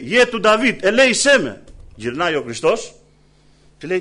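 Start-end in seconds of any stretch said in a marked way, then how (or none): 0.0-1.6 higher, louder plea for mercy; a blind beggar crying out again, louder and higher. Retold or imitated. Preacher voicing blind Bartimaeus calling to Jesus again, louder plea. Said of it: "Και φωνάζει".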